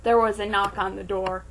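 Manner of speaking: ordinary delivery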